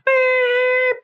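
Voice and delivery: long high-pitched beep sound; high mock beep. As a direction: imitate the machine beep, held high. Imitated answering machine beep.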